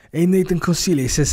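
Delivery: hammed-up foreign accent reading the message; put-on Russian-Italian accent. Host reading listener question in mock Russian accent. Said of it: "I don't know why I'm doing Russian".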